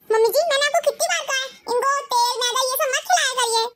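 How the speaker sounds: a bossy bahu telling off her mother-in-law; shrill daughter-in-law. Acted. Ekta scolds mother-in-law. (सास को डाँटती तेज़ बहू, ऊँची आवाज़ में)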